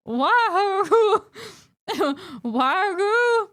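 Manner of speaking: high, loud cartoon cheer; hammed-up Italian cartoon cheer; Mario-style cheer. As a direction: belt out a cartoon plumber's whoop. Nintendo cheer impression.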